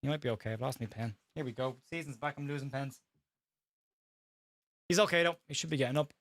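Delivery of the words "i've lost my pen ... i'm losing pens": muttered aside. Real, not acted. Aside while commentating.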